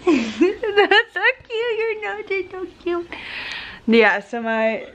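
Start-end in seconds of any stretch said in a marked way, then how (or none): 0.0-3.1 high, slow, laughing gush. Real, not acted. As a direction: coo through laughter at the dog. Gushing over the dog.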